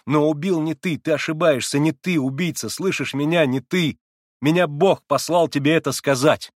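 rapt, insistent plea; beside himself. A narrator's reading. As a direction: press it on him as if compelled. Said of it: "как бы вне себя".

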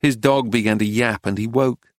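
ordinary delivery

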